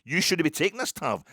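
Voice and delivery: will the penalty taker to back off; excited inner voice. Retold or imitated. Fan willing Tav not to take the penalty, loud excited urging. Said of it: "I'm thinking".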